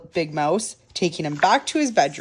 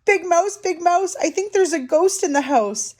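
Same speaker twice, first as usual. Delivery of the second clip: high-pitched little mouse alarm; crying out; 9 semitones higher than usual. A narrator's reading. Little Mouse telling of a ghost, high alarmed cry. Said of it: "and he cried".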